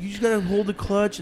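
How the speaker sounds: high, breezy mock-cousin voice; imitating a cousin, pitched high; cousin's instruction. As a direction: toss it off like it's obvious. Cousin explaining dirt bike.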